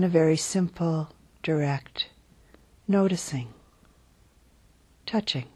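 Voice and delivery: unhurried, calm body-scan voice; guided meditation. Real, not acted. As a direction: guide them gently into settling, phrase by phrase. Leads a guided body-awareness meditation.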